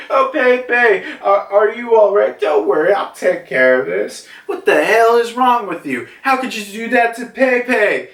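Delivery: high, worried then angry female voice; upset Ute. Retold or imitated. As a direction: play Ute fussing over Pepe, then snapping. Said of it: "Ute is like".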